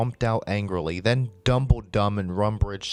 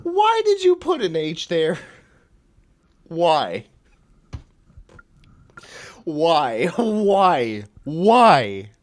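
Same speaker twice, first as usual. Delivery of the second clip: high, loud, pleading wail of why; exasperated disbelief; 7 semitones higher, 5 dB louder, slower than usual. Real, not acted. Reader pleading over an H put in "sit", exasperated. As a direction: wail it repeatedly in comic despair.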